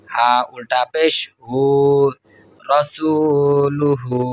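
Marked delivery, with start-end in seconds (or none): 2.6-4.2 slow, chanted reading of রসুলুহু; melodic recitation. Shown as a demonstration. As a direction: chant রসুলুহু with long held vowels. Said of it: "demo of joined reading".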